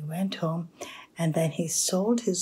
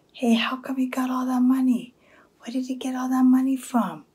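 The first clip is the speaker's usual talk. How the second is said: high, loud, incredulous questioning; acted astonished neighbor, loud and high; nosy neighbor; 7 semitones higher, 6 dB louder, faster than usual. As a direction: demand to know, voice pitched way up. Acted nosy neighbor demanding an explanation.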